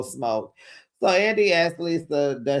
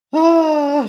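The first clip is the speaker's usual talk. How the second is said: drawn-out high-pitched exclamation; big raised "ahh"; loud high "ah"; 13 semitones higher, 8 dB louder than usual. Real, not acted. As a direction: let out a loud, high cry of disbelief. Own exclamation at Lisa checking out.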